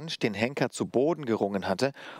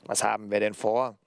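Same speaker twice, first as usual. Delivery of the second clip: cruel puppet taunt in altered timbre; Punch, cruel voice. A narrator's reading. Puppet challenges the hangman figure, cruel taunting voice. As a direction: taunt him as a wicked puppet. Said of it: "Woher kam nur diese grausame Stimme?".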